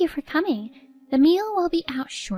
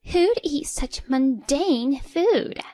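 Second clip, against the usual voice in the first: slow, high, scornful sneer; sneering mother-in-law; 6 semitones higher, 4 dB louder, much slower than usual. Acted. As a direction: drag out the contempt.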